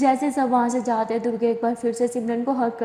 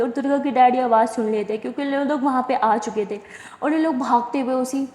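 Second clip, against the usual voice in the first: usual speaking voice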